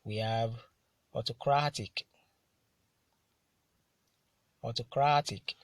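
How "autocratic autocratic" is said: "autocratic" with CRA hit harder; careful model reading of "autocratic", stressed on CRA; penultimate stress. Shown as a demonstration. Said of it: "stressed on the penultimate syllable".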